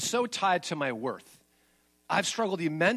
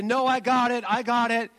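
insistent brush-off; 6 semitones higher, 5 dB louder than usual. Retold or imitated. Brushing off wife at the door.

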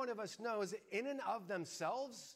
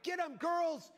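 put-on high, loud cheer to the girls; rally the troops, loud and high; excited shout; 9 semitones higher, 5 dB louder, slower than usual. Dad yelling at his daughters to shoot.

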